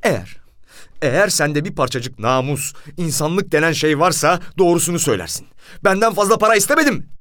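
indignant appeal climbing to a high shout; wronged man's rising fury; losing self-control. A narrator's reading. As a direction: start restrained, build to a loud outburst. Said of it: "ne yaptığımın farkına varamaz hale gelmiştim".

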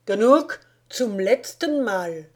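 loud, slow final warning; stern German order. A narrator's reading. Guard officer giving the prisoner a last loud warning in German.

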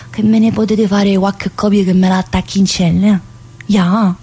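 ordinary talk, nothing marked

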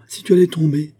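high, loud, worried exclamation; alarmed warning. A narrator's reading. Ladov fearing his fiancée might fall, alarmed warning.

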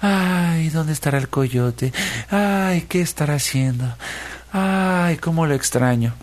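high sighing "ay"s, lovesick; sighing longing. A narrator's reading. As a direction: sigh it out, pining for him. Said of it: "suspirando y diciendo". (suspirando enamorada)

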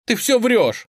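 high, loud, upset denial; tearful outburst. A narrator's reading. Girl in tears denying the boy's claim, tearful outburst. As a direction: cry it out in protest. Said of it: "Настя снова расплакалась".

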